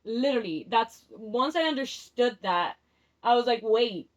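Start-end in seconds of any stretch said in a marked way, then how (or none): none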